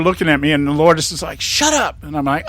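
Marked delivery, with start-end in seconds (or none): 1.4-2.0 mock hush. Companion hushes him in crowd.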